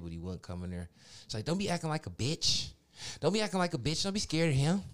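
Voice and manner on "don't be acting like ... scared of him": higher, louder scolding of a dog; telling off a scared dog. Retold or imitated. Voicing herself scolding the dog.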